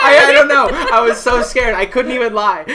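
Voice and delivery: loud, high, laughing confession; real hysterical exasperation; helpless amusement. Real, not acted. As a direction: protest your own blunder while cracking up. Cracking up over own blunder.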